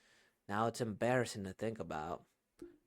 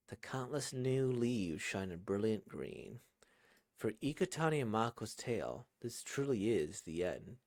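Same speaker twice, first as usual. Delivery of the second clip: story narration; 3 semitones lower than usual. A narrator's reading. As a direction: read the closing narration unhurried and tender. Narrator passage read aloud.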